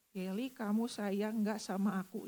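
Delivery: lightly voiced God, slow and soft; the voice of God asking tenderly, slowed. Preacher retells God asking Yeli, slow and soft. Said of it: "saya dengar sebuah suara bilang begini".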